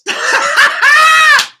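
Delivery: loud high laughing shout. Real, not acted. Laughing at the lyric, loud howl.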